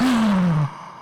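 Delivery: big staged gasp of horror; long dramatic gasp; shocked gasp. Acted. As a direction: suck in breath at a frightening sight. Boy opens blinds, sees a wolf.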